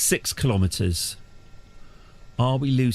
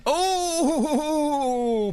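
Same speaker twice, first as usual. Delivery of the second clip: panicked laughing yelp. Real, not acted. Plane bounces on landing.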